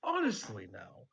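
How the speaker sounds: high, affronted "me?" with feigned innocence; mock offended. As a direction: play wounded pride, not meaning it. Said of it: "[name] looks very offended".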